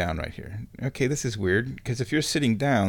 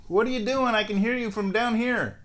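higher call up to the cat; high pet talk; 10 semitones higher than usual. Real, not acted. Calling up to her yowling cat, higher pet voice. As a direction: coo up the stairs at a noisy cat.

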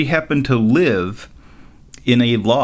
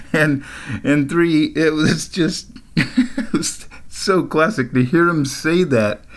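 higher, giggly, struggling to get it out; laughing while talking; 5 semitones higher, slower than usual. Real, not acted. Telling why the coworker's line was classic, laughing. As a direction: talk through real giggles. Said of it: "I start laughing".

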